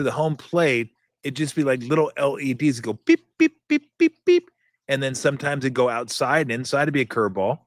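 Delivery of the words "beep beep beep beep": very high, sharp electronic beeps; beeping imitation. Shown as a demonstration. Showing the pitch moving across the screen, high electronic beeps. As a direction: chirp it like tiny LED lights.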